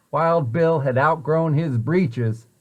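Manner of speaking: ordinary delivery, nothing marked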